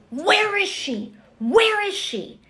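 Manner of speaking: high yelled search call; retold yelling. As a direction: yell it, hunting for someone. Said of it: "these yells".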